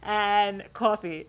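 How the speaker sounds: Long Island "coffee" with a rounded aw; "coffee" as a Long Islander says it; Long Island accent. Shown as a demonstration. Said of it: "with an accent".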